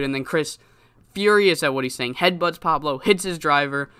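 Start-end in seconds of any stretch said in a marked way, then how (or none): none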